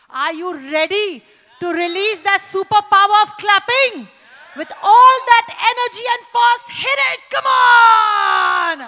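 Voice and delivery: high, very loud pep shout; full-force cheering command; rousing shout. Acted. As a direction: drive the crowd to clap harder. Host urging loud clapping.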